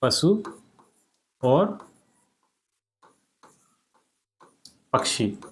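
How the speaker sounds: पशु और पक्षी word by word with pauses; slow dictation. Shown as a demonstration. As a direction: dictate for copying. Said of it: "तो ये हो जाएगा पशु और पक्षी". (धीरे-धीरे लिखवाना)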